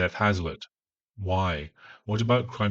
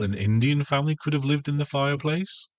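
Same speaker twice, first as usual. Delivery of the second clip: high, rising disbelief; incredulous question; 6 semitones higher than usual. Real, not acted. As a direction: echo it back as an appalled, high-pitched question. Reviewer reacts in disbelief to clip.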